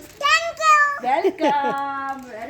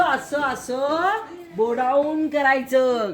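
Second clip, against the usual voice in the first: slow demo; much slower than usual. Shown as a demonstration. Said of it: "आजोबांना असं".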